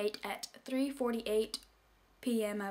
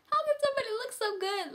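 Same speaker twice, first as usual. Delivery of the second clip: much higher put-on voice, dreamy and lovestruck; swooning girl; 13 semitones higher, 3 dB louder, faster than usual. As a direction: swoon over him in a high girly voice. Swooning over crush in class.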